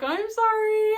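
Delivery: high, loud mock wail; wailed apology. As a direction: wail the apology, stretching the end. Retold apology amid laughing fit.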